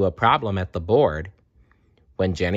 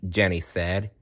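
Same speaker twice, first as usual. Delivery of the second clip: plain reading voice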